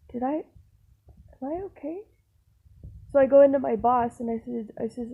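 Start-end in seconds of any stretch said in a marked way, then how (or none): 0.1-2.0 slow, higher and softer, frightened question; timid worry. Retold or imitated. Nervous worker asking the owner if she's in trouble. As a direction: ask it small and scared. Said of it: "And I was just like".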